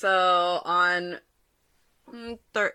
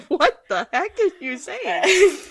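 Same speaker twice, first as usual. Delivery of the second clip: higher, slow, laughing disbelief; teacher reliving her confusion at the boy, higher and laughing; baffled; 11 semitones higher, 4 dB louder than usual. Retold or imitated. Retold baffled reply.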